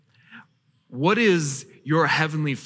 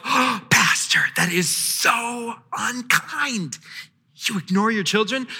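a hint of a dismayed congregant clutching pearls; shocked listener; 4 semitones higher than usual. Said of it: "before you think to yourself".

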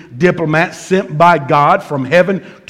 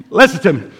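ordinary talk

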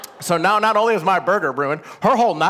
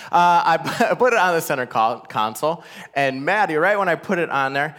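ordinary talk, nothing marked

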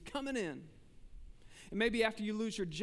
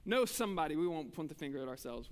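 normal talking voice

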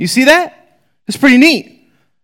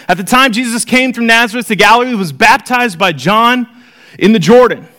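raised, brisk Bible reading voice; scripture reading; 4 semitones higher than usual. Acted. Teacher reading the gospel to the class, lifted reading voice. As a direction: read the passage out to the class.

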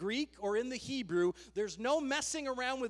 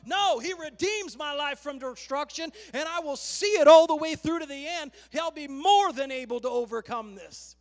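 much louder, higher, full-force declaration; defiant preaching shout; 4 semitones higher, 9 dB louder than usual. Real, not acted. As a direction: shout down the despair with faith. Preacher defies despair.

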